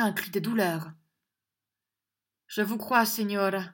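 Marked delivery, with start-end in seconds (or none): 2.5-3.6 sad reply. A narrator's reading. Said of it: "dit-il tristement".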